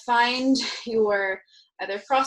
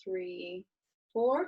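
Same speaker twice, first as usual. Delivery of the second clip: drawn-out counting on an inhale; slow breath count; 2 semitones lower, 7 dB quieter, much slower than usual. Shown as a demonstration. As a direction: count slowly while breathing in. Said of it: "So for example".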